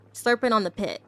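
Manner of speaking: ordinary delivery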